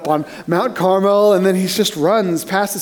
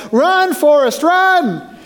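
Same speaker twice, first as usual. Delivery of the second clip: a high, urgent call of the name; mock yell; 8 semitones higher, much slower than usual. Friend yelling at the boy to flee the bullies, high urgent call. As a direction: shout him into running like the friend. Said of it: "his friend Jenny yells".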